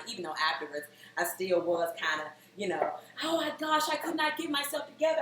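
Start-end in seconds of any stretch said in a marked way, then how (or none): 3.2-5.2 high, mock-flustered explaining; acted embarrassed excuse; flustered apology. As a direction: explain yourself in a raised, embarrassed pitch. Imagined flustered explanation to uncle.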